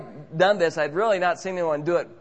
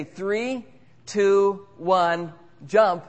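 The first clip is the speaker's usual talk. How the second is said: countdown; 3 semitones higher, much slower than usual. Retold or imitated. Worker telling him how he will jump, called-out countdown. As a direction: call out a countdown as the one in charge.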